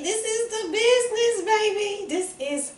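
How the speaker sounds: excited high gush. Real, not acted. Gushing over glazed cake.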